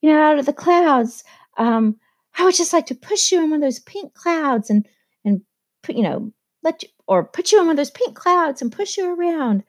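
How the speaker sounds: high-pitched, silly imitation of Daisy; airy mock exclamation, pitched well up; voicing Daisy. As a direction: lift the voice high, mock-dreamy, as if quoting her. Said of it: "silly comment where she's like".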